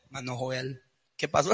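the once-defiant member coming back meekly; meek, humbled. Retold or imitated. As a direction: shrink into a humble little voice. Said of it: "vienen mansitos".

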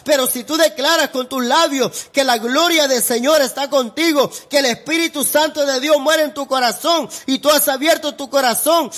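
high-pitched, driving sermon delivery; fervent pulpit proclamation; impassioned preaching. Real, not acted. Preaching builds to full force.

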